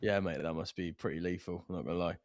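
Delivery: soft, low muttered aside; quiet aside. Real, not acted. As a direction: drop your voice for a wry aside. Aside after costume comes off.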